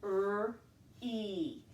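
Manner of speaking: R-E said apart, letter by letter; letter sounds. Shown as a demonstration. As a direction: sound out R-E slowly. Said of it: "The first syllable is re".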